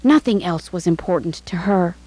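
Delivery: ordinary delivery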